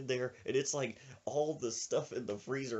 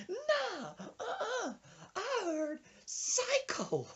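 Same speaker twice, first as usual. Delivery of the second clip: high falsetto refusal; offended old woman; much slower than usual. Retold or imitated. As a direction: dismiss it in a squeaky old-lady voice. Old woman rejects the excuse.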